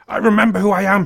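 loud, high cry of realization. A narrator's reading. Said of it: "he cries".

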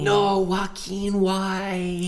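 high, slow shriek of dismay; shrieking jackpot winner. Real, not acted. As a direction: squeal it out in shock. Dismay at Joaquin reveal.

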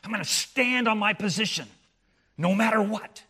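loud, high preaching peak; a fiery preacher's shout; preaching at full force. Acted. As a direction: proclaim it from the pulpit, full voice.

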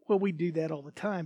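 normal talking voice

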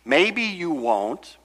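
drawn-out maybe you will, maybe you won't; slow, weighing uncertainty. Shown as a demonstration. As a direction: let each maybe hang slowly, unsure. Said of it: "the idea is".

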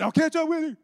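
high, loud yell from a distance; calling out. Retold or imitated. Man running off shouts back to his friend, calling out. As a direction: holler it as you dash away.

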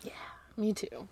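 soft, breathy me too under the breath; whispered. Real, not acted. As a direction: whisper it as a quiet aside. Quiet agreeing aside.

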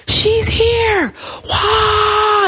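high, excited crowd cry; mock crowd cheer, drawn out and high; cheering angels. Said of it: "there's this loud roar in heaven".

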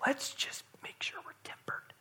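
whispered, timid caution; mock cautious whisper. Pastor mocking a timid church, whispering. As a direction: whisper it like a nervous worshipper. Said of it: "we wouldn't talk like that".

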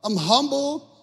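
high, stretched proclamation; preaching as Jesus. Acted. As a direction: lift each phrase high and hold it. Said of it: "He says".

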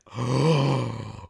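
sharp surprised gasp; imitating the wife's startled gasp; mock gasp. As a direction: gasp like the wife did. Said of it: "and she went".

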